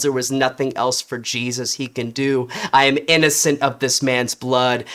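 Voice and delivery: ordinary delivery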